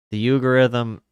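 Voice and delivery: "algorithm" said as "uh-gorithm", the l swallowed; a loud slip, "algorithm" mangled into "uh-gorithm"; botched algorithm. Real, not acted. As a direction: blurt "algorithm" loudly as "uh-gor-ithm". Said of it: "did I just say algorithm?".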